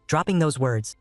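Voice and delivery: ordinary delivery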